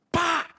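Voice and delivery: loud, high "pá!"; sudden sharp imitation of a smack; imitated belt crack. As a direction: crack it out as a ringtone. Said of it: "Vou pôr um toque do celular, assim".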